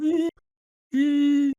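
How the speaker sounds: high squeak like a talking puppet; a silly high squeak from the dummy; high squeaky arse voice. Retold or imitated. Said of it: "Just go".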